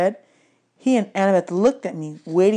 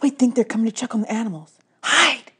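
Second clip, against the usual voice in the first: fast, higher urgent hiss; hissed warning; 4 semitones higher than usual. A narrator's reading. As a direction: hurry them into hiding, sharp. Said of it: "Annabeth hissed".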